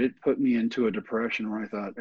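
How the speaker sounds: ordinary delivery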